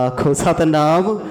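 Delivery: loud call for the sangat to answer Satnam. Real, not acted. Preacher calls on the listening congregation, loud rising Satnam.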